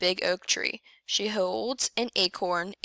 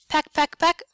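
short high louder pecks; sharp pecking sound; 8 semitones higher, 5 dB louder than usual. A narrator's reading. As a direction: tap each peck out high and crisp. Turkeys pecking sound word.

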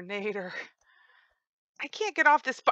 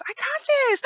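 much higher squeak on I got this; squeaky self-pep; 4 dB louder than usual. Real, not acted. Player psyching himself up at a jump, squeaky high. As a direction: squeak it out like a nervous cheer.

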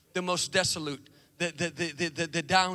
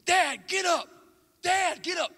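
pleading shout; 9 semitones higher, slower than usual. Acted. Son begging his fallen dad, high pleading shout. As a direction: yell for dad to rise. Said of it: "Rocky's son comes over".

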